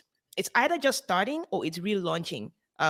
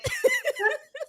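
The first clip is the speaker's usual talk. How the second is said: high laugh. Real, not acted. Laughing at own impression.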